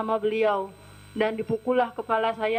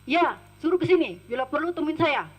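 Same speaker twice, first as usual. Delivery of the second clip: raised, challenging mock retort; retold imperious answer, pitched up; imitated defiant reply; 4 semitones higher, 4 dB louder than usual. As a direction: dare them to come, as the boss would. Employer answering defiantly from sofa.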